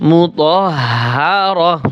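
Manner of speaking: মুতাহহারাহ very slowly, long breathy hh and final "rah"; pausal h ending. Shown as a demonstration. Teacher showing the word at waqf, slow h ending. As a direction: stop slowly on মুতাহহারাহ, dropping -tin for h. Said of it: "ওয়াকফ করার সময়".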